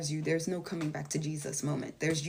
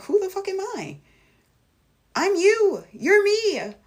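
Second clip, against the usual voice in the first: much higher and louder, an emphatic exclaim; retold outburst; 13 semitones higher, 9 dB louder than usual. Answering people who doubt her, high and loud. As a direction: fire it back like it's obvious. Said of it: "and I'm like".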